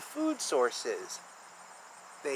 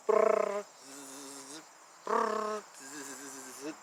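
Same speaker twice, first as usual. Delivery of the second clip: bee buzzing noise; 4 semitones lower, much slower than usual. Pretend. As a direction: make the bee's wings hum. Imitating bee wing noise.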